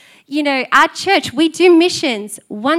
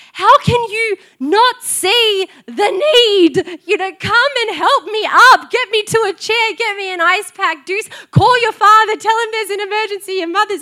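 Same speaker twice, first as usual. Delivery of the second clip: exaggerated frustration; 7 semitones higher, 5 dB louder than usual. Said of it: "I'm exaggerating a little bit".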